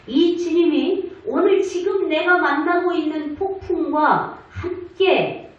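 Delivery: usual speaking voice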